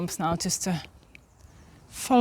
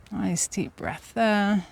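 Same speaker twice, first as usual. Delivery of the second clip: slow calm breathing cue; 5 dB quieter, slower than usual. Acted.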